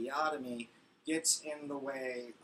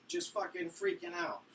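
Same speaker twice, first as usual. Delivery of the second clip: ordinary talk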